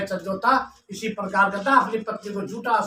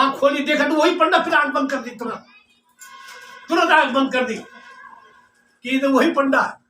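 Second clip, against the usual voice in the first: usual speaking voice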